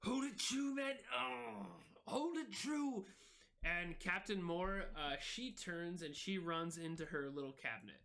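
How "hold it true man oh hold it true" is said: higher, drawn-out sailor's urging; Izzy's urging voice. Acted. Izzy to Garrett at the helm, a high urgent call. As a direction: press the helmsman to hold course.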